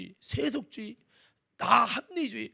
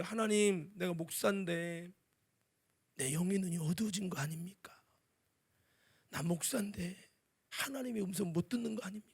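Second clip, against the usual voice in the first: soft prayer; 4 semitones lower, 8 dB quieter than usual. Retold or imitated. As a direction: confess softly to God. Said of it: "저는 이런 기도해요".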